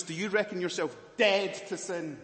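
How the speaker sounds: ordinary talk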